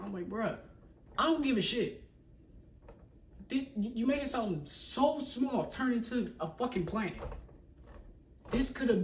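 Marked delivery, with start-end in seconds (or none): 0.3-6.3 exasperated outburst. Retold or imitated. Retelling heated car argument.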